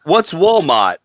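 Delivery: ordinary talk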